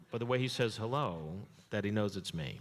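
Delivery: usual speaking voice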